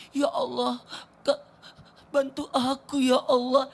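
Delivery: imitating a crying child. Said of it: "Tapi dia nangis".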